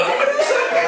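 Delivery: aduh sakit yang dilebihkan, tinggi dan tertawa; rengekan drama. Retold or imitated. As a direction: tirukan anak yang berlebihan mengeluh. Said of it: "Lu drama". (rengekan drama tinggi sambil tertawa)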